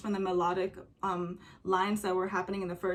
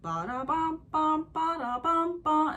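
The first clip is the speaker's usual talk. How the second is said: higher, louder slow ba-da-bum; instrument imitation; 8 semitones higher, 3 dB louder, slower than usual. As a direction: mimic the drum pattern. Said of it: "the instrument that they're using".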